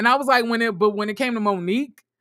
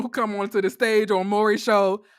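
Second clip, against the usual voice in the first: usual speaking voice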